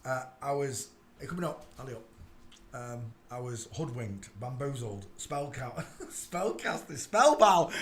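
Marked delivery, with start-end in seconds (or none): 5.2-7.7 breaking into laughter mid-list; laughing. Real, not acted. Host cracks up listing words for being fooled, laughing. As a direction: stumble through the words with a laugh.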